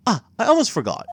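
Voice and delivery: put-on polite old-fashioned bellhop; lightly voiced formal concierge; concierge bellboy voice. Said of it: "what's a good bellboy voice".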